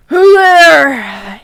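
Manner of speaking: loud, gruff demand; angry shout. Acted. Janitor shouting at the hidden intruders. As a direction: bark it into the dark. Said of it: "he shouted angrily".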